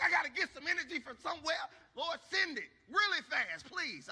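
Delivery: high, loud plea for strength; desperate prayer. Acted. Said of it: "I've never been so spiritual and prayerful".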